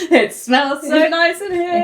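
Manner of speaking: high, loud gush through laughter, slowly; mock customer gushing. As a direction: laugh out a dreamy customer line. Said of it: "they're like".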